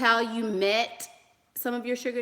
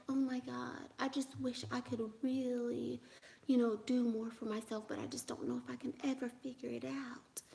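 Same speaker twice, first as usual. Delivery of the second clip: put-on soft helpless high baby voice, much softer; 3 semitones higher, 11 dB quieter than usual. Shown as a demonstration. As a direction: breathe it out small and helpless. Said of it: "but it's a baby voice like".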